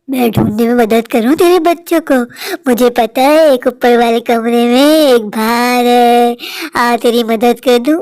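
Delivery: deep, stretched-out ghostly offer; low, slow haunting voice; menacing ghost. Acted. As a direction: lure her upstairs in a low spectral voice. Ghost offers sinister help.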